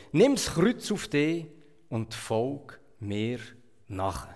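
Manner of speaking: normal talking voice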